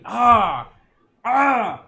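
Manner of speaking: loud, sudden ah of disbelief; real outraged shout; outraged yell. Real, not acted. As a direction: blurt it out in outrage. Outraged reaction to villain.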